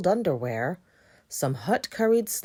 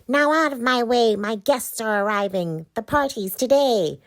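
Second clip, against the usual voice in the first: witch voice; 5 semitones higher, 6 dB louder than usual. Acted. Witch orders.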